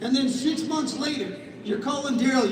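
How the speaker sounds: ordinary talk, nothing marked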